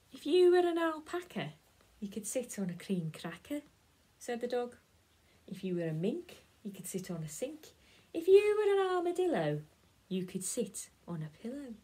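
lightly voiced dog, big loud high lift on the animal names; playful dog. Dog offers the cat silly seats in rhyme, playful.

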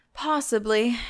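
ordinary delivery

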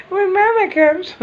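squeaky high imitation again, slow; high mock wife voice. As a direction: echo her answer in the same tiny voice. Repeats the mimic laughing.

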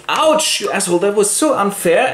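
high yelp, then quick protest; indignant Hamlet. Acted. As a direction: whine at a cheat, fast and high. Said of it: "And Hamlet goes".

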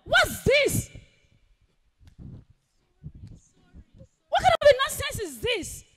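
outraged. Acted. Woman outraged at spilled water.

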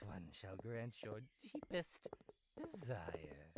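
very low, slow demonic promise; slow demon voice offering a wish; deep demon voice. Acted. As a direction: rumble it like a demon granting wishes. Game demon voice.

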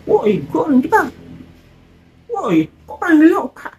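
high put-on voice; high, half-hearted comic shout; mock annoyed yell. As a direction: protest like a fed-up foreigner. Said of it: "Masa setiap ada orang teriak".

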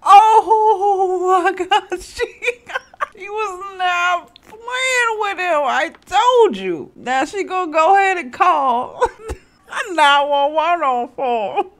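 high, slow wheezing laugh through tears; laughing until she cries; crying with laughter. Real, not acted. Mom cracking up at the kids' antics, cry-laughing.

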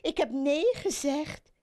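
high lady-frog voice; startled exclamation. A narrator's reading. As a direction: blurt it out high. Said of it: "riep zij uit". (hoge, verraste uitroep van de kikkerdame)

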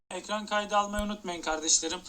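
ordinary delivery

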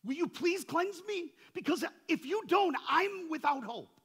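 high, loud, pleading cry; desperate leper's plea. Retold or imitated. Leper crying out to Jesus for cleansing, desperate and high. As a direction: beg like a leper with no hope left. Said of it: "you could go to him as a leper and go".

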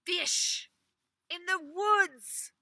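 high, loud, slow disbelief; incredulous master. Acted. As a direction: repeat it in disbelief.

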